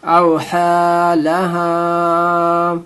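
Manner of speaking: "أَوْحَى لَهَا" chanted with long held aa vowels; stretched standing zabar. Shown as a demonstration. Teacher showing the vowel mark, "أَوْحَى لَهَا" with stretched aa. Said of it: "کھڑا زبر کو کھینچنا ہے".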